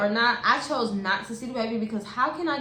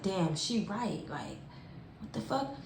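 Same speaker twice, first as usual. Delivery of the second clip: inner thought; 3 semitones lower, 10 dB quieter than usual. Retold or imitated. Inner thought after mom's suspicion.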